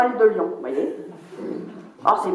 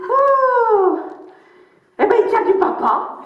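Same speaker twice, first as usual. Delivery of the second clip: fausse admiration; 10 semitones higher, slower than usual. Retold or imitated. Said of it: "Et tu lui dis".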